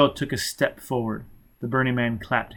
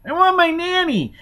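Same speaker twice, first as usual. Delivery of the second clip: put-on very high, loud, begging little-girl voice; pleading frightened child; 6 dB louder than usual. A narrator's reading. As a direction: wail for Nanny like a terrified small child. Child cries for Nanny.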